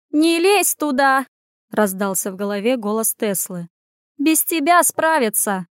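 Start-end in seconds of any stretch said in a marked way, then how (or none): 0.1-1.3 high, slow cry of warning; urgent warning. A narrator's reading. Tesla warns Anya away from the fire, urgent. 4.2-5.7 higher, drawn-out insistent call; insist, pitched up and slow; urgent warning. A narrator's reading. Tesla tells Anya to stay out, urgent. Telepathic warning during the fire.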